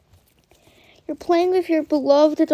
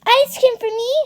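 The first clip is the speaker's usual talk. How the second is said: put-on high, delighted child character voice; Elizabeth; 10 semitones higher, 5 dB louder than usual. Shown as a demonstration. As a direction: squeal it like an excited little girl. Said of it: "I give you my Elizabeth accent impression".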